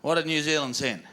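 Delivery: normal talking voice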